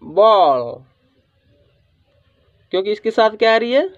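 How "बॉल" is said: "बॉल" with a long rounded aw; model pronunciation. Shown as a demonstration. Teacher shows how to read it, "बॉल" with long aw. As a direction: sound out "बॉल" as a model word. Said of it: "इसको हम क्या पढ़ेंगे".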